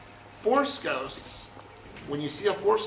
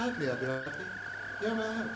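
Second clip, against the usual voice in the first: Princess Leia; 2 semitones higher, 6 dB quieter, faster than usual. Acted. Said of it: "Little message says".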